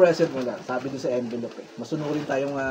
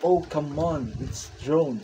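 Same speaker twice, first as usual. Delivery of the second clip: usual speaking voice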